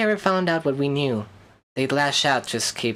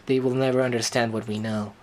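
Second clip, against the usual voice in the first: ordinary talk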